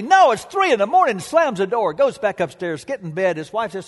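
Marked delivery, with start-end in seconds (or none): 0.0-1.2 quick, sharp, louder refusal; husband snapping at a late caller; angry. Retold or imitated. Husband turns away the drunk at the door, angry. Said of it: "he says angrily".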